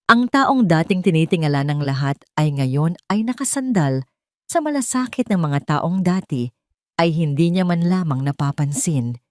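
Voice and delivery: ordinary delivery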